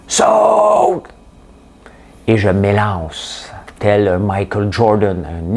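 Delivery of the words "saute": long cri très aigu et fort. Retold or imitated. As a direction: hurle l'ordre à ton coéquipier en plein match. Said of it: "Et il crie". (crié à pleine voix)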